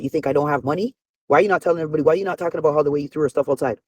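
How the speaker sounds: fast, relentless string of accusing questions; rapid rant. Acted. Calling out the man on air, fast accusing rant. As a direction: fire the questions off without a breath.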